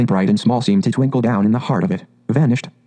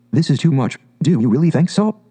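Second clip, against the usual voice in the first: ordinary delivery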